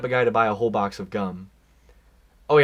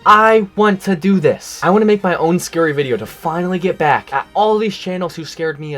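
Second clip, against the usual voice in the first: excited; 5 semitones higher, 6 dB louder than usual. Real, not acted. Excited declaration of inspiration.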